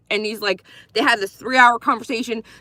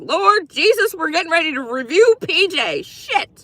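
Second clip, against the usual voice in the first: mock dismay; 6 semitones higher than usual. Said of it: "I'm like".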